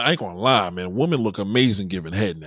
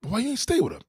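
high incredulous question; 11 semitones higher, faster than usual. Retold or imitated. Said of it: "You're like".

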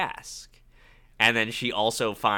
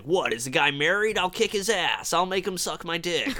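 mock tough guy; 8 semitones higher than usual. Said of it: "Jim Belushi where he's like".

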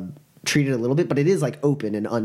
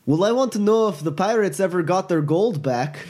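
eager Gurky; 8 semitones higher than usual. Acted. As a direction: ask it like a curious little creature begging for the story's end. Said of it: "Gurky goes".

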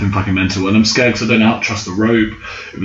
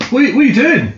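high, panicked disbelief; a yelp of shock at the trainer; much faster than usual. Retold or imitated. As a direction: squeal it out in alarm. Trainer climbs off without him.

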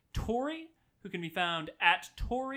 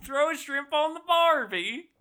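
high, loud mock Aussie catchphrase; exaggerated fake Australian accent; bad Australian accent; 8 semitones higher, 7 dB louder than usual. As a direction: ham up a cartoon Australian accent. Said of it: "This is my Australian accent".

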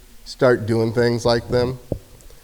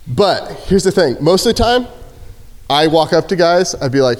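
normal talking voice

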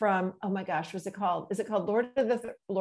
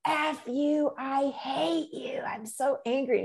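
put-on higher, strained voice of a wild twelve-year-old, slow on I hate you; feral angry girl; 5 semitones higher, slower than usual. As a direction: spit it out like a feral child lashing out. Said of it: "And she's like".

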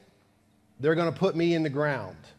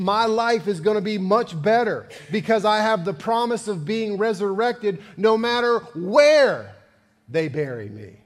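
rising preaching; 5 semitones higher, 5 dB louder than usual. Acted. Preaching builds to a peak.

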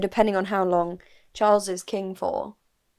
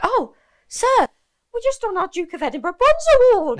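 put-on high, loud sweet child voice; polite posh kids; 14 semitones higher, 8 dB louder than usual. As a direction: chirp it like eager scouts. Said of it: "And they were like".

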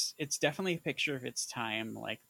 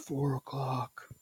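Seagal impression. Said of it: "There is a little Keanu to yours".

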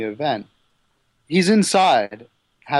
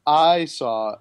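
ordinary talk

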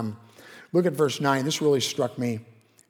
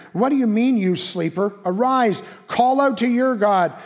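higher, louder rousing call; captain's urgent call; 5 semitones higher, 5 dB louder than usual. A narrator's reading. The captain rouses sleeping Jonah, higher louder urgent call. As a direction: shake the sleeper awake with urgency. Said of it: "the captain came and said to him".

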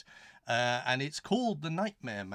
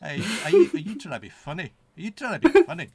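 mock Scottish challenge; 6 semitones higher, 10 dB louder, faster than usual.

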